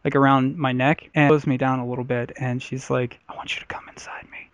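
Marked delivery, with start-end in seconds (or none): 3.3-4.5 breathy unvoiced whisper. Girlfriend whispering in bed, hushed and unvoiced. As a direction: whisper it right against his ear. Retold partner's whispered request.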